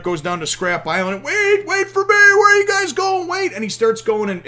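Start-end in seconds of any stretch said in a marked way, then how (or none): 1.2-3.5 high shouted call. Retold or imitated. Usopp screaming after his crew as they sail off, high frantic shout.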